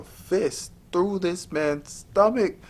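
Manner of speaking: high, shocked retelling. Real, not acted. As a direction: pitch jumps high in disbelief. Shocked at the twist.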